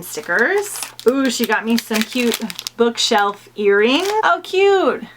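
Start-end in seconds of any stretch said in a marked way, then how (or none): none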